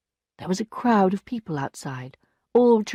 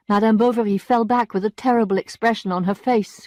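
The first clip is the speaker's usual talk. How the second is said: plain reading voice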